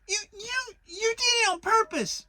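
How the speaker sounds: spluttering through soap; sputtering. A narrator's reading. Piglet spluttering at Kanga with a mouthful of soap, high and angry. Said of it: "sputtered Piglet".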